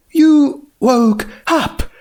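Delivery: can't believe what she hears; surprised question. A narrator's reading. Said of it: "Rarity asked".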